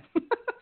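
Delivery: squealing high laugh; amused high cackle. Real, not acted. Laugh after quoted curse.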